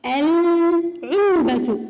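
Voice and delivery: العلبة very slow with a clear lam; stretched العلبة with the l voiced; slow moon-letter demo. Shown as a demonstration. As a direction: draw out العلبة as al-ʕulba. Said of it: "اللام تلفظ هنا".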